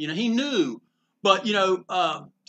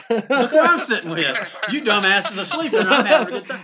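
louder, boasting through laughter; laughing gloat; 3 semitones higher, 7 dB louder than usual. Retold or imitated. To friends who slept in, gloating with a laugh about breakfast with Eisner. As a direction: rub it in while laughing.

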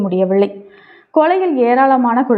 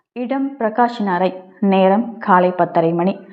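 ordinary delivery

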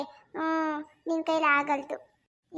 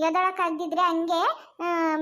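ordinary delivery

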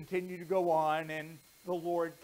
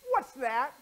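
stern mom; 6 semitones higher, 9 dB louder than usual. Acted. Mom confronts Steve.

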